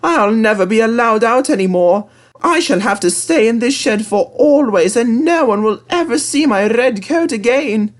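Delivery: high, loud, sorry-for-himself engine voice; locked-in engine feeling sorry for himself, high voice; sad little engine. Acted. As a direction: moan about never getting out again. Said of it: "he thought sadly".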